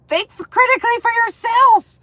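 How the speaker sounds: high, loud exasperated cry; exasperated squeal. Real, not acted. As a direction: nearly shriek it in frustration.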